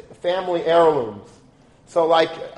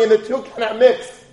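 raised, forceful voice with high pitch; emphatic father; 7 semitones higher, 6 dB louder than usual. Retold or imitated. A father insisting to his son, forcefully. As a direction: drive home the lesson like a stern father.

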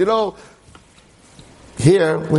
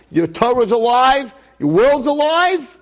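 rising joy; 8 semitones higher, slower than usual. Retold or imitated. Nurse announcing joyful news.

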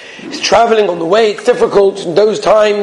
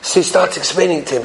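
ordinary talk, nothing marked

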